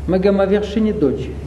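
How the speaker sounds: ordinary talk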